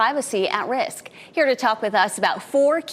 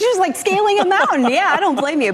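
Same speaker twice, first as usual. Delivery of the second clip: excited; 8 semitones higher, 5 dB louder than usual. Real, not acted. Anchor reacts to slide footage.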